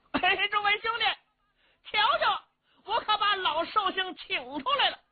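Cheng Yaojin hollering high and proud; brash hero showing off to his sworn brothers, loud and high; high, loud call to the crowd. Acted. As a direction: call out to everyone, pitch way up.

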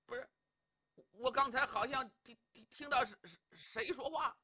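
flustered, halting and high; nervous stammer. Acted. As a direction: stammer it out, caught off guard. Startled man stammers excuse.

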